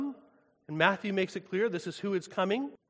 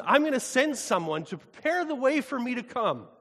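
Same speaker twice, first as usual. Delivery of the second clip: higher and louder solemn divine proclamation; proclaiming God; 4 semitones higher, 8 dB louder than usual. Acted. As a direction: announce it as God pronouncing judgment. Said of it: "He says".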